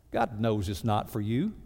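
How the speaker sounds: ordinary talk, nothing marked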